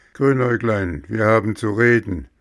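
loud, stern, fast call of her name; stern summons. Acted. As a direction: summon the guilty elf sharply. Angry Nikolaus summons her. (strenge, laute Vorladung)

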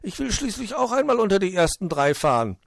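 high, loud stubborn plea; demanding. Acted. Borstel wants to place in the top three, insistent. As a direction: insist on a fair turn.

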